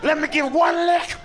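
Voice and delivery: high, pleading puppy voice; squeaky dog begging for a lick. Acted. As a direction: beg for a lick like an eager dog.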